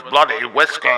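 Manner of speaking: mock a British radio guest, loud and low; mock British accent. Podcaster aping the documentary guest, loud mock British voice. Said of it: "this British motherfucker was talking on the radio".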